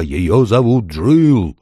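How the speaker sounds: slow, drawn-out call; owl shouting. A narrator's reading. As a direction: shout it out for deaf ears. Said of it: "крикнула сова". (протяжный крик совы глухому)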